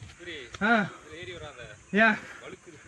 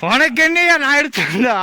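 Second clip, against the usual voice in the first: high, raised-voice retort; exasperated outburst; 7 semitones higher, 4 dB louder than usual. Acted. Climber quarrelling with companion on the hill, exasperated outburst. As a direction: snap back in a high, indignant voice.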